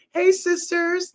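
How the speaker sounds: high, slow witchy call; lightly voiced Hocus Pocus witch greeting. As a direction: croon it like a Sanderson sister. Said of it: "like Hocus Pocus".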